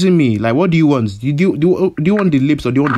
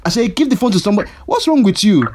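angry; 9 semitones higher than usual. Acted. Shouting at prank caller.

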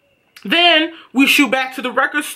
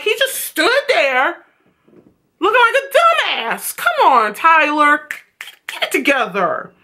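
1 exasperated; 5 semitones higher than usual. Real, not acted. Exasperated at Tyler.

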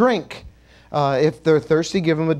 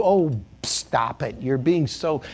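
mock bashful; 5 semitones lower, 3 dB quieter, slower than usual. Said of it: "can't help but bless somebody".

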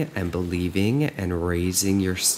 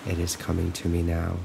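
calm, even affirmations with long silences between; guided meditation; 6 dB quieter than usual. Acted. Coach looping affirmations for listeners to follow, calm and measured. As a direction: recite evenly, leaving room to repeat.

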